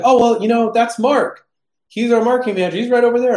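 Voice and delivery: high, chatty booth salesman voice; sales guy. Retold or imitated. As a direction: play the eager sales guy pointing you to his colleague. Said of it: "he's like".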